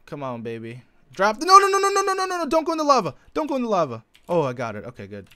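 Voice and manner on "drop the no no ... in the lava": high, loud rapid pleading; real panicked gamer yell; frantic no's. Real, not acted. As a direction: shout no over and over as the drop slides away. Item falling toward lava.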